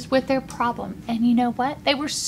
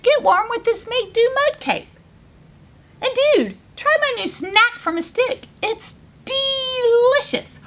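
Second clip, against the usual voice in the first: high-pitched cheerful dude character; upbeat rhino; 8 semitones higher than usual. A narrator's reading. Rhino offers little hare a snack, upbeat.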